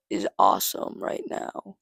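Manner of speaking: deadpan fake boast; sarcastic. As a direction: say it like you don't mean a word.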